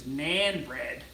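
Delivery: naan bread in a Canadian accent, "naan" with a flat "a" as in "nan"; light Canadian accent on "naan bread", "naan" said like "nan". Shown as a demonstration. Streamer showing viewers his Canadian accent on naan bread. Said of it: "I can say it in my Canadian accent".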